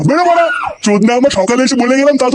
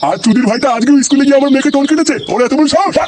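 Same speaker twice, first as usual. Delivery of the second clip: high-pitched, indignant rant; outraged complaint; angry accusation; 6 semitones higher than usual. Acted. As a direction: rail at him, pitch held high. Angry complaint about grandson harassing daughter.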